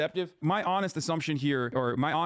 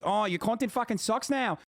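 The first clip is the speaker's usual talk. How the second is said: high, mocking, passable Australian accent; slightly clumsy Australian impression, pitched up; attempted Australian accent; 7 semitones higher than usual. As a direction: mimic a whiner in a rough Aussie accent. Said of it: "slightly better attempt at an Australian accent".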